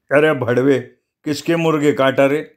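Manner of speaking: mild narrated angry shout; shrill angry yell. A narrator's reading. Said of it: "रुका तारस्वरात ओरडला".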